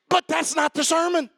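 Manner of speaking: ordinary talk, nothing marked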